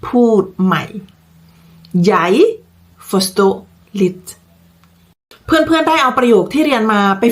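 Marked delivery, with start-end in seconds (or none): 2.1-4.2 slow model phrase with a pause after each word. Shown as a demonstration. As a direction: say each word separately for learners. (พูดช้าๆ ทีละคำ)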